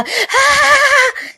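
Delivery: long, very high held scream; imitated scream. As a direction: demonstrate her desperate shriek on one note. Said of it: "De tanto que ela gritava". (imitando grito agudo e prolongado)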